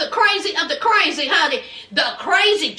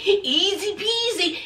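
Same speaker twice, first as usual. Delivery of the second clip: creepy trooper voice; 4 semitones higher than usual. Retold or imitated. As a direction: lightly mimic the creepy trooper's catchphrase. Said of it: "he kept using terms like easy peasy".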